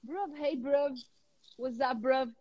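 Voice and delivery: London street "bruv", short and casual; London street slang. Shown as a demonstration. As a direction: greet your mate with "bruv", London style. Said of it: "vira bruv".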